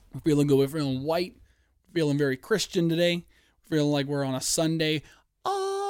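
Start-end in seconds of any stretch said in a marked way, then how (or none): 5.4-5.8 high, sustained sung note; high choral tone; church choir "ah". Shown as a demonstration. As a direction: hold a long, high church "ah". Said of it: "That's what they do in church".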